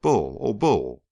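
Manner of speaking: restrained narrated shout; calling out. A narrator's reading. Harry calls for Bull at Joe's bidding, a mild shout. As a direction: call the name as if to someone indoors, not at full volume. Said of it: "Harry obediently shouted".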